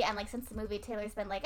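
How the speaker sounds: ordinary delivery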